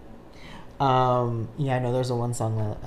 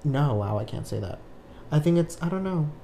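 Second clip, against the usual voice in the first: normal talking voice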